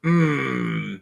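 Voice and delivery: ordinary delivery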